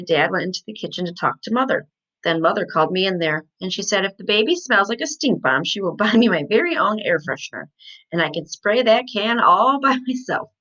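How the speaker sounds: ordinary delivery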